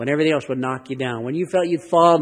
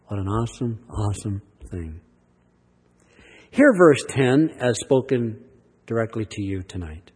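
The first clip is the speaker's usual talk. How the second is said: usual speaking voice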